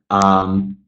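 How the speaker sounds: ordinary talk, nothing marked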